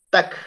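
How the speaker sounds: Tac by itself, final k released; a lone "Tac", ending on k; syllable tac alone. Shown as a demonstration. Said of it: "Tac se lee hasta ahí". (Tac sola, con k final audible)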